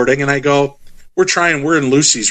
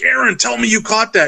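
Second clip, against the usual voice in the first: higher-pitched, hopeful appeal to the cameraman; urgent plea; 9 semitones higher than usual. Retold or imitated. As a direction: plead for confirmation. Anxiously asks cameraman about footage.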